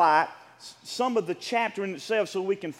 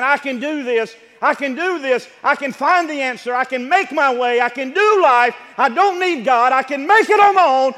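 loud, high, building to a shout; preacher acting out defiant self-reliance; insistent boasting; 5 semitones higher, 10 dB louder than usual. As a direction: pile up each claim harder and louder.

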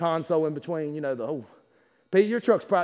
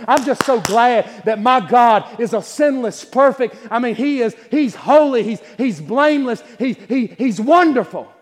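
loud, high preaching cry, slowing at the peaks; full-force preaching; 6 semitones higher, 9 dB louder, slower than usual. Acted. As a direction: shout the praise over the church. Preacher builds to praise.

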